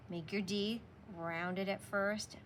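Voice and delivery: ordinary delivery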